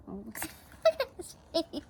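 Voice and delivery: ordinary delivery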